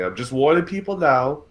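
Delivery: ordinary talk, nothing marked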